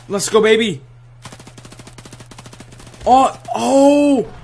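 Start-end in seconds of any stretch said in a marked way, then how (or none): 3.1-4.3 high, louder startled burst; surprised yelp. Real, not acted. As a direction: yelp as the shots go wide. Surprised mid-fight.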